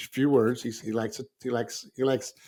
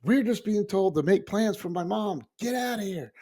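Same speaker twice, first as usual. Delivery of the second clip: scoffing disbelief; 8 semitones higher than usual. Retold or imitated. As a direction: brush off an absurd question, voice pitched up. Brother outraged at relative's question.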